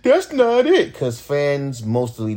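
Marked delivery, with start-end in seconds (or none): 0.0-0.9 high, loud mock fan dismissal. Rapper mimicking fans who tell him a lo-fi song is not it. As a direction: sneer it like a know-it-all fan. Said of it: "Motherfuckers would sit there and tell me".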